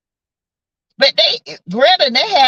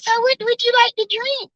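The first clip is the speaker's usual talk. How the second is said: high, soft sugary waitress; 13 semitones higher, 4 dB quieter than usual. Retold or imitated. Mocking the waitress who ignored her, high sweet voice. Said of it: "She was like".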